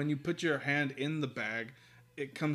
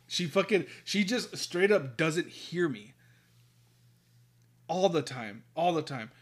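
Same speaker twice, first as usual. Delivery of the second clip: worked-up, raised-voice rant; getting angry; 3 semitones higher, 5 dB louder than usual. Real, not acted. Ranting to cohost about partner mishearing him, angry. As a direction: vent real exasperation at being misheard. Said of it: "I'm getting so angry".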